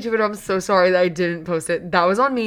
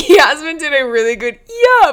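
laughing; 6 semitones higher, 8 dB louder than usual. Real, not acted. Laughing at friend's yeah.

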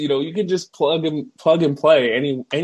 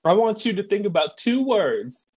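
high, wound-up coach voice; manic coach impression; 9 semitones higher than usual. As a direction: pump the team up, high and strained. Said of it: "He's like".

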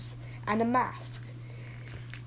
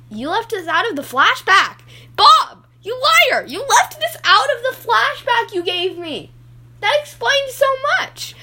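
high, loud accusing yell; angry shouting; 12 semitones higher, 9 dB louder than usual. Acted. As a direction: scream at the liar. Accusing Bob of lying.

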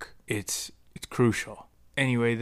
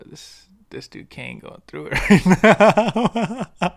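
higher and louder, breaking into laughter; laughing through the words; 7 semitones higher, 6 dB louder, slower than usual. Retold or imitated. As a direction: giggle through it at a friend's expense. Friend laughing at his misery.

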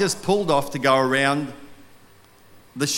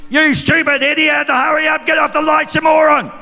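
high, loud furious yell at another driver; road rage yelling; 13 semitones higher, 7 dB louder than usual. Retold or imitated. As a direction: scream it like a raging driver stuck behind someone. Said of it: "And I'm going".

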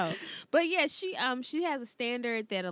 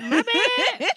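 loud, high laughing apology; cracking up while owning it; 12 semitones higher, 9 dB louder than usual. Real, not acted. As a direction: laugh it out, pitched way up. Laughing apology after harsh remark.

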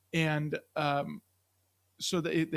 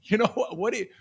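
usual speaking voice

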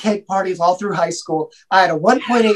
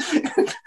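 awkward laugh; 12 semitones higher, 6 dB quieter than usual. Real, not acted.

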